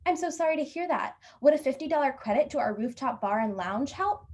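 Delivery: high, solicitous customer-service voice; apologetic hotel clerk. Acted. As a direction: apologise brightly, then lift into the offer. Clerk responds with an offer.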